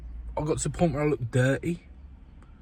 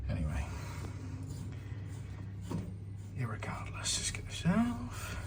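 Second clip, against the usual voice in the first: hushed, mostly whispered muttering; whispering; 2 semitones lower, 16 dB quieter than usual. Real, not acted. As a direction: keep it to a near whisper while working. Working quietly under the sink.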